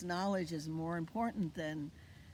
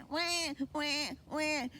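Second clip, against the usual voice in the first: high little mock baby wail; baby cry; 8 semitones higher, slower than usual. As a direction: echo a baby's cry. Baby's cry in naming song.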